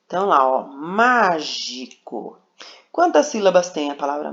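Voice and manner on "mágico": "mágico" bem devagar, MÁ forte e longo; sílaba tônica destacada. Shown as a demonstration. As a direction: separe as sílabas de "mágico", carregando no MÁ. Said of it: "É a sílaba má". ("mágico" silabado, com peso na primeira sílaba)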